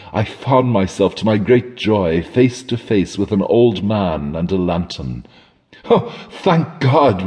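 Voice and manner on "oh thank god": slow, higher burst of relief; relieved exclamation. A narrator's reading. Lost traveller greeting the old man, relieved cry. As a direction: blurt out thanks in relief. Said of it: "the exclamation that burst involuntarily".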